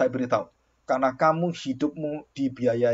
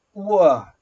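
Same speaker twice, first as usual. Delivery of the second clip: louder, higher gasp of "Wah"; mock surprise; 5 semitones higher, 9 dB louder than usual. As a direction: act out the teacher's shock. Surprised exclamation in retold story.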